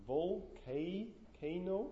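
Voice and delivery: Volcano very slowly, "vol-KAY... KAY-no?"; syllable drawn out. Shown as a demonstration. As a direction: stretch and repeat the CA of Volcano, rising. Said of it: "Is it a diphthong?".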